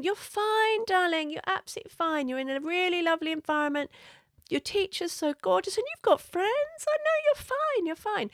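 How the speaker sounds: high, sing-song soothing voice, a touch overdone; reassuring parent. Retold or imitated. Parent insisting to an upset child that they're fine, high and sweet. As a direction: coo it to the child like a fussing parent.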